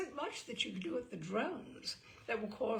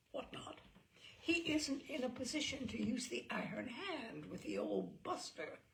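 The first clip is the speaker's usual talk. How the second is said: plain reading voice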